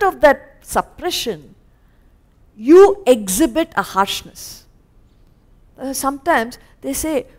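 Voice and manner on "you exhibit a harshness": usual speaking voice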